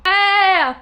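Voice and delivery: high, loud cheer. Real, not acted. Cheering herself on mid shelf rearranging, excited. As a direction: celebrate out loud. Celebrating progress on her shelves.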